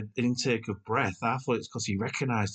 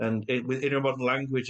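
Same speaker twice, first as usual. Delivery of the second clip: ordinary talk, nothing marked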